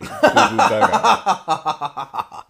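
hammed-up baby talk; baby babble. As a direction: coo it like an infant, laughing. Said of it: "like a baby".

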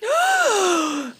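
high delighted gasp; sharp excited intake of breath. Real, not acted. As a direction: gasp with glee. Excited about Kennedy topic.

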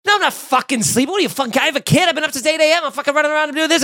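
hammed-up angry snapping, fast; mock furious rant. Imitating Bobby furious at being asked if he's asleep, mock rage. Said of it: "He's like".

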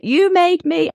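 put-on high, accusing little-kid voice; a light, high imitation of an accusing six-year-old; Nia. Mother imitating her six-year-old blaming her, in a high child voice. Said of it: "my six-year-old Nia is always like".

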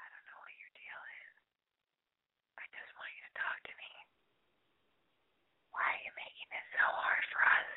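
urgent breathy whisper into the phone. Real, not acted. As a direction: whisper it, pleading and annoyed. Hissed at a silent caller.

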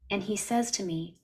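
usual speaking voice

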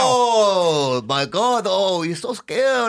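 slow, wailing, put-on fright; mock terror. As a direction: moan in hammed-up fear. Acting a frightened hold-up victim.

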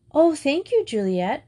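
high, lightly shy little voice; shy thanks. A narrator's reading. Younger girl thanking an older one for help, shy. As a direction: thank her with a touch of shyness. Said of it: "replied Amy shyly".

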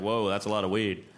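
lightly voiced overwhelmed teenager; stoned teen. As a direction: drop low like a wide-eyed stoner kid. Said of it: "I was like".

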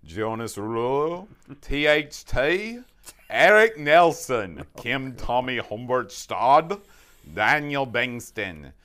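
slow accent sliding from Australian toward cartoon Russian; wandering mock accent with swooping pitch; bad foreign accent. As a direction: drag each name through a silly foreign accent. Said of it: "from Australian English to Boris Badenov".

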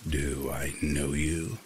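slow, low, scratchy stranger; creepy stranger drawling the words. A narrator's reading. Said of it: "his voice low and scratchy".

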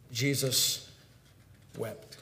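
soft, low, with a long pause between words; hushed verse reading. A narrator's reading. Preacher reading the shortest verse, hushed and solemn. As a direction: let each word fall quietly and separately.

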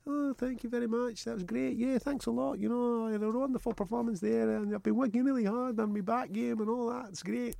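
high, soft, polite fighter; meek interview voice. Pretend. Host mocks a timid post-fight interview, soft and high. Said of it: "No, fuck that".

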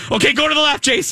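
high-pitched urgent order, retold; retold frantic yelling. Colin directing his co-pilot on the ride, mock screaming. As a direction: imitate a panicked co-pilot's yell. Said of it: "Colin's screaming at me".

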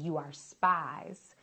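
very slow, stern accusing Joseph voice; rough accusation. A narrator's reading. Joseph accusing his disguised brothers, slow and stern. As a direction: drag out the charge like a hard-eyed governor. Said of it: "spoke roughly to them".

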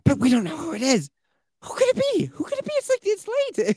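very high, fretful mother voice; anxious mom. Retold or imitated. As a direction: squeak out the worry like a nervous mom. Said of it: "She's like".

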